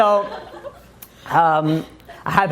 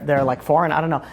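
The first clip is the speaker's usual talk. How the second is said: ordinary talk, nothing marked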